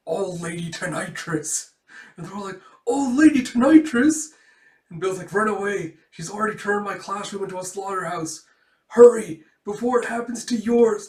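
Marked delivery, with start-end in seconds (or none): 2.9-4.3 high, loud shocked question; alarmed echo. Acted. Students echo nickname in alarm. 5.3-11.1 high, loud acted alarm; urgent dying-messenger plea, pitched up; panicked warning. Bill warns the others to flee.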